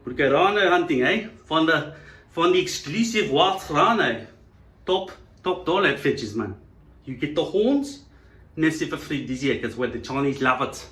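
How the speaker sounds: put-on South African accent. South African touting rhino hunts, put-on South African accent.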